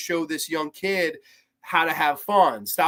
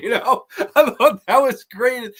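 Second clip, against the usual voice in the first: laughing; 7 semitones higher, 6 dB louder than usual. Real, not acted. Laughing after retold joke.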